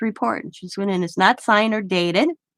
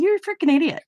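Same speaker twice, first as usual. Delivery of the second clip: exasperated inner thought; 10 semitones higher, 4 dB louder, faster than usual. Retold or imitated. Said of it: "I'm just thinking".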